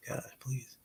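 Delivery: barely voiced whisper; whispered aside. Real, not acted. Host tempted by food right here, whispered aside. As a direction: drop to a tiny whisper.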